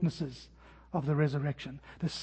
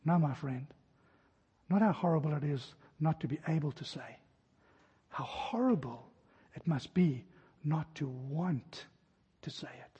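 under his breath; 3 semitones lower, 7 dB quieter than usual. Retold or imitated. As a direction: barely get the words out, weak and dying. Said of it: "under his breath with his broken voice".

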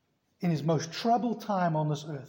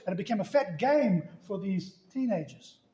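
ordinary delivery

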